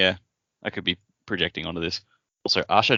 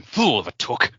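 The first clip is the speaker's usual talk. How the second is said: wizard quote; 5 semitones higher, 3 dB louder, slower than usual. Retold or imitated. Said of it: "just going".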